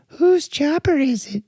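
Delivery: put-on high, slow girlish question; Pulp Fiction girlfriend. Girlfriend asking whose chopper it is, high and slow. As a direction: draw the question out sweetly. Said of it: "That's, that's Pulp Fiction".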